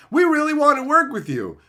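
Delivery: high, mock-sweet marketer voice. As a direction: parody a middleman circling back. Mocking marketer emails.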